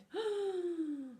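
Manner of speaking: high, long gasp of fright; panicked gasp. Acted. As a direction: suck in air in terror. Shark's panicked breath.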